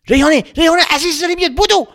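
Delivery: loud, high urgent calling out. Acted. Hananeh warning Reyhaneh that grandma is coming, loud and urgent. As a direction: hurry your sister, loud and high.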